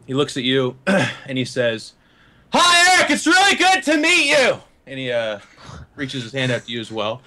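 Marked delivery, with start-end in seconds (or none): 2.5-4.6 booming high-pitched greeting in a different voice; really loud. Acted. Modified soldier greets Eric with an uncontrolled, very loud voice. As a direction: blast it out as if volume is out of control. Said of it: "It's really fucking loud, and it's, like, a different voice".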